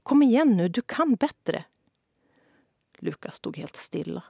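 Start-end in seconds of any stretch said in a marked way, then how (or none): 0.0-1.6 high, loud pushing; pushy yell. A narrator's reading. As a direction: keep yelling at him to do better. Mother keeps yelling from sideline.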